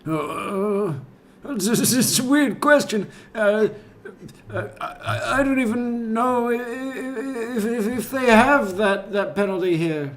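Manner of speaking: very high, stammering mock interviewee; Chris Watts impression. Host redoing Chris Watts's interview answer, high flustered stammer. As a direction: stumble nervously over a loud, squeaky answer. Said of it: "We should do the whole thing again".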